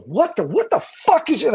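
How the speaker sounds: high-pitched startled protest; startled outburst. Retold or imitated. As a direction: relive a yelp of disbelief, pitch jumping right up. Retells reacting to sudden pain.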